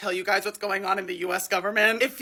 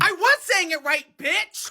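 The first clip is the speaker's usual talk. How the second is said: indignant; 8 semitones higher than usual. Real, not acted. Vindicated outburst.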